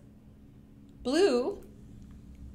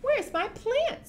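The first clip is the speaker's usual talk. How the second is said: farmer's wife, much higher voice; high fussy farmer's wife asking; 13 semitones higher than usual. Acted. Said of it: "said the farmer's wife".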